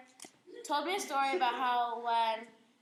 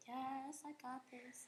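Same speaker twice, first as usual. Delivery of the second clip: put-on cool kid; 12 dB quieter, slower than usual. New driver feeling cool at the wheel, quietly smug. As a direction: play it softly cool, pleased with yourself. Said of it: "she feels like a cool kid".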